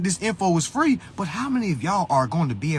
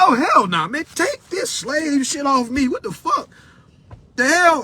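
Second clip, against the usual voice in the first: indignant and incredulous, voice raised; outraged refusal; 9 semitones higher than usual. Retold or imitated. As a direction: reject it hotly, pitched way up. Retells rejecting the word humble.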